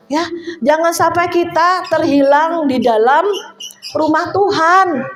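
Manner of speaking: preaching warning. Real, not acted. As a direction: press the warning on the congregation. (khotbah peringatan dengan nada tinggi)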